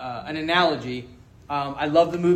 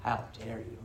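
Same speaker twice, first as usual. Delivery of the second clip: mock offended; 7 semitones lower, 14 dB quieter than usual. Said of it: "And I'm like".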